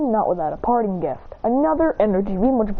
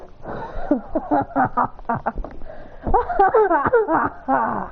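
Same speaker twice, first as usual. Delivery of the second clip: breathy chuckle building into higher laugh; acted laughter; 3 semitones higher than usual. Taunted about shooting fire, he breaks into acted laughter. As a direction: laugh in two long bursts. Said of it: "he laughs, going".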